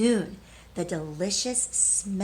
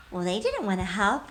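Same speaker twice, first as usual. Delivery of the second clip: ordinary delivery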